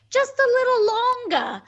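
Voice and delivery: put-on high, loud New York kid pleading; New York accent. Kid begging to stay out in a New York accent. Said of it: "He goes".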